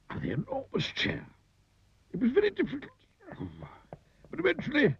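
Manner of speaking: high-pitched effortful struggle; straining climb. A narrator's reading. Narrator climbs the enormous chair leg, straining high. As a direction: haul yourself up on each phrase.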